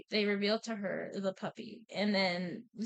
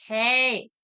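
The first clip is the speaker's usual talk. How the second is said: loud, blunt interruption; acted-out loud telling-off; mock scolding call; 2 semitones higher, 9 dB louder, much slower than usual. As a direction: call them out sharply, in play. Daughter interrupts arguing dads.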